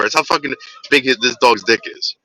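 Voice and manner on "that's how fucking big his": usual speaking voice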